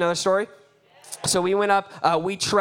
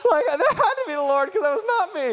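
usual speaking voice

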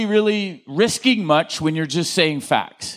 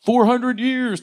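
imitating couple; 5 semitones higher, slower than usual. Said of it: "I hear some people go".

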